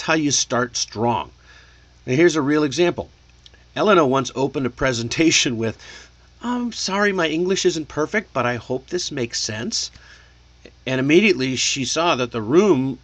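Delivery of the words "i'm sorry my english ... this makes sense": acted hesitant apology, starting high; imitating an unsure presenter saying sorry; unsure, apologetic. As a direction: open timidly, pitched up, then tail upward for approval. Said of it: "looking at her like she's unsure".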